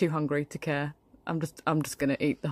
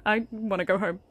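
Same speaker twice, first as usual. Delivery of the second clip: ordinary talk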